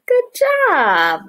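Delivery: high gasping good; excited praise. Acted. Teacher praises children, excited gasping praise. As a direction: cheer them on with a gasp.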